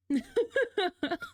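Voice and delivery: shrieking giggle; high squealing laugh. Real, not acted. Laughing at chat.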